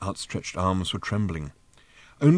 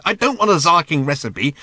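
higher, louder exasperated outburst; irritated interruption; 6 semitones higher, 5 dB louder than usual. A narrator's reading. Ford interrupting Arthur's omelette recipe, exasperated. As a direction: cut in, loud and fed up.

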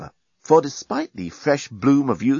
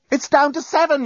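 much higher panicked yell; 4 dB louder than usual. Acted. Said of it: "yelled the journalist".

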